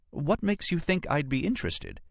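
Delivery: high, irritated woman's character voice; Nora challenging. Acted. Archaeologist challenges his presumption, high indignant voice. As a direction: challenge him, pitched far up.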